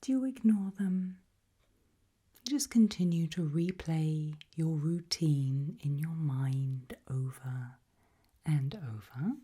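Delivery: ordinary delivery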